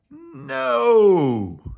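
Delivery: a big shouted no. A narrator's reading. Boris shouting at Morris, loud shout. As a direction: bellow it out as Boris. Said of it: "shouted Boris".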